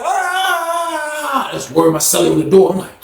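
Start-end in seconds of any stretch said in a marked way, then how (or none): 0.0-1.5 high, short shout. Retold or imitated. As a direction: shout him down. Guards shout.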